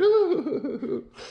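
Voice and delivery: high cooing hoot; flattered titter. Acted. Halima flattered by the flower, high cooing titter. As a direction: coo in a high fluttering voice. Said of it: "And she's flattered".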